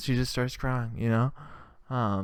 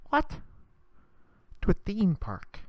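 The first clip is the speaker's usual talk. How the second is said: usual speaking voice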